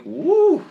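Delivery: sharp, very high, loud "Woo!"; shocked whoop. Real, not acted. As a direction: yelp it in mock disbelief. Reacting to the child's diet.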